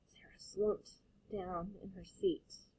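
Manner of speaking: slow whisper; whispered narration. A narrator's reading. Reading the sad moment, slow quiet whisper.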